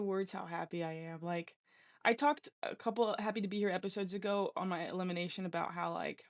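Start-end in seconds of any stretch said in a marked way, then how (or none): none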